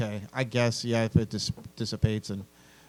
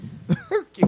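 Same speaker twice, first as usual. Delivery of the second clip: shrieking high laugh; high squeal of laughter. Real, not acted. Laughing at the silly pet name. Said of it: "amused burst".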